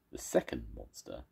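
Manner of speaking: plain reading voice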